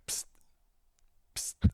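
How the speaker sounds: voiceless "psst" to get attention; imitated hiss before a whisper; two short psst noises, spaced apart; whisperer's psst. Shown as a demonstration. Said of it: "when you're gonna whisper to somebody".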